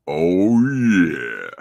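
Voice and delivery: booming low oh yeah; loud deep Oh yeah. Acted. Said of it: "my morning deep voice".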